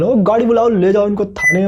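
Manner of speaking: half-acted yell, calling for the van; retold police yelling. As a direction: policeman shouting to take them to the station, mock yell. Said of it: "सारे चिल्ला चिल्ला के बोले".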